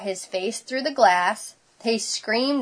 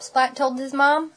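ordinary delivery, nothing marked

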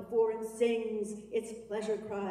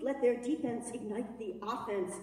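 ordinary talk, nothing marked

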